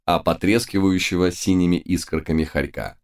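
usual speaking voice

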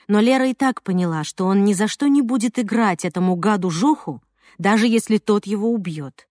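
usual speaking voice

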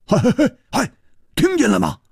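high, impatient repeated calls; agitated, high-pitched questioning; anxious urging. A narrator's reading. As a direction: press urgently for an answer. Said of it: "胡铁花急着说".

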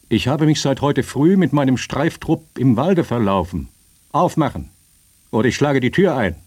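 loud, high-pitched soldier's complaint turning to threat; commanding, exasperated yell; impatient shouted demand. A narrator's reading. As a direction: call out forcefully through a locked door. Soldier demands entry through locked door.

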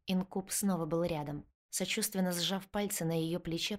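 plain reading voice

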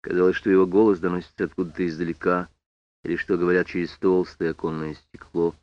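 usual speaking voice

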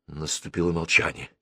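plain reading voice

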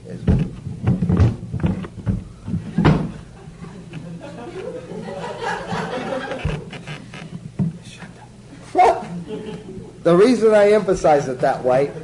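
usual speaking voice

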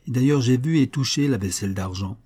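usual speaking voice

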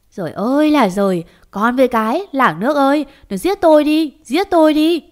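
despairing outcry that builds to a high cry; loud wailing. A narrator's reading. Mother learning her son lost everything, loud wailing.

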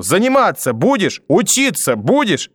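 high, slow, pounding each word; screaming, word by word. A narrator's reading. Grandmother stabbing scissors into desk, screaming word by word. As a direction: punch every word like a stab. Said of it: "втыкая на каждое слово ножницы в парту".

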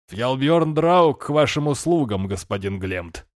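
slow, grand mock bow in voice; mock courtly. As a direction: introduce yourself with mock ceremony. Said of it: "и он отвесит поклон".

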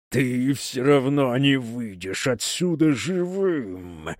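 slow, grinding demon threat; grating voice. A narrator's reading. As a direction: grind the words out slowly. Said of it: "пообещала Евангелина скрежещущим голосом".

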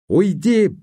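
high, loud cry of go away; shout. A narrator's reading. Ruth slaps Herbert and screams at him. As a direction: scream it at him through tears. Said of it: "закричала она".

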